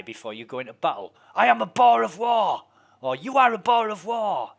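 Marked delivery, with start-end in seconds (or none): 1.3-2.6 warrior battle cry. Retold or imitated. 3.2-4.5 warrior battle cry. Retold or imitated.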